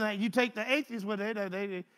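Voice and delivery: ordinary talk, nothing marked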